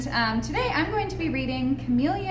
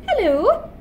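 put-on girl chameleon; 9 semitones higher, 5 dB louder than usual. Girl chameleon greets in story.

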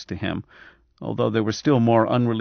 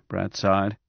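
usual speaking voice

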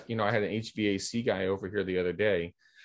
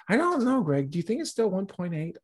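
imitating the seller, voice pitched much higher, unsure; mock client voice; 11 semitones higher than usual. Seller calling Greg about her listing price, in a high, unsure mock voice. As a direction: ask in a high, doubtful client voice.

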